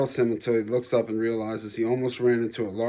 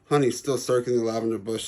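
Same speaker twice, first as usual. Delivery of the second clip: usual speaking voice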